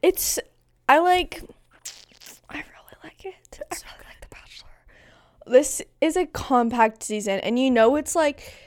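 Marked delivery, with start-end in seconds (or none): 2.5-4.7 unvoiced whisper, hushed confession. Real, not acted. Friend whispers her love for The Bachelor. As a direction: whisper it like a guilty secret.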